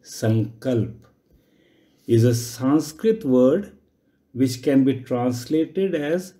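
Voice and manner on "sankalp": "Sankalp" ending on lp, no final a; demonstrated pronunciation. Shown as a demonstration. Yoga teacher showing how the term is pronounced, "Sankalp" without a final vowel. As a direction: say "Sankalp" in two syllables with a falling tune. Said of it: "I repeat".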